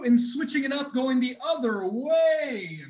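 ordinary delivery

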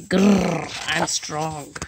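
lightly voiced growly dinosaur; growling tough dinosaur. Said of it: "And he's like".

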